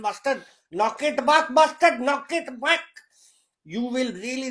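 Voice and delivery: ordinary talk, nothing marked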